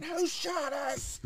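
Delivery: very high, drawn-out mock shriek; hammed-up frightened squeal; panicked mom. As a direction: imitate mom crying out in alarm.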